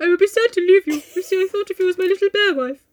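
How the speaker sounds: put-on high, quickening comic accent; Mister Bear. Bear sad to leave Jo, hammed-up character voice. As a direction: confess fondness in a silly put-on voice. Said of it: "so he's like".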